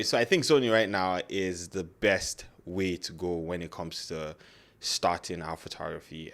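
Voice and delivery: ordinary delivery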